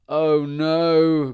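loud, high drawn-out groan of dismay; a big, storybook groan of "oh no". A narrator's reading. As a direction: groan it out loudly. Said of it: "groaned Stan".

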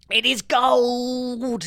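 high, loud, long-drawn cry; baron's exultant shout. A narrator's reading. Baron finding gold in the sack, exultant shout. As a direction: stretch it out in loud delight. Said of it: "he shouted".

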